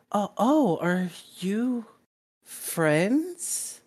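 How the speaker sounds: put-on high, tentative little kid; small child voice. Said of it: "you hear a small voice".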